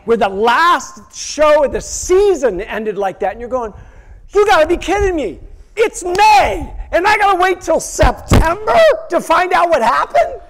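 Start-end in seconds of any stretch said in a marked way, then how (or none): 4.3-10.4 high, loud exasperated wail; frustrated outcry. Retold or imitated. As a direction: cry out at the cliffhanger, much higher and louder. Season finale cliffhanger outrage.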